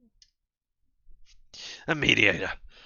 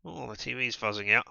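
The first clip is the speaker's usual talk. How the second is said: ordinary talk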